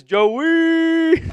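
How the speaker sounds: a streamer reading a fan's greeting in a high call; calling the name. Retold or imitated. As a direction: sing the name out like a viewer calling. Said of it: "William says".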